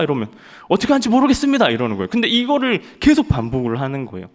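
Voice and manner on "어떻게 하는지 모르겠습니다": high, loud and fast; mock private rushing his answer; flustered recruit. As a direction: blurt it out in a panic. Recruit admitting ignorance.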